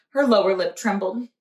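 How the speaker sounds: plain reading voice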